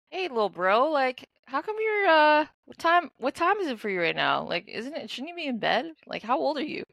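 concerned big-sibling questions, high; talking to a kid. Retold or imitated. Retold gentle questions to little kid.

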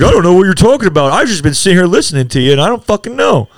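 high, loud, fast mocking voice; mocking imitation. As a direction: throw his words back at him, sneering. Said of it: "this cocksucker over here is like".

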